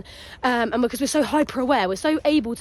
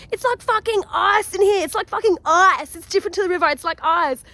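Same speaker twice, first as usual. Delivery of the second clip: bad South African accent; 5 semitones higher, 3 dB louder than usual. South African swimmer in icy water, exaggerated South African accent. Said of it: "I have a bad accent".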